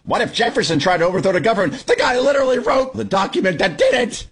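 high-pitched incredulous rant; exasperated yelling. Real, not acted. Radio host corrects Al Sharpton on history, yelling. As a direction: yell it, voice climbing sharply in outrage. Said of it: "Sorry to yell on a Sunday morning".

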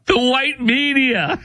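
high, stretched-out echo breaking into laughter; laughing mock repeat. Real, not acted. Host echoing the congresswoman's phrase to listeners, laughing incredulity.

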